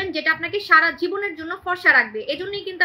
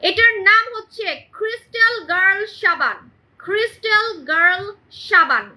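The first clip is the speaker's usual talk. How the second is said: slow product name reveal; 3 semitones higher, 3 dB louder, slower than usual. Acted. Announcing new soap's name.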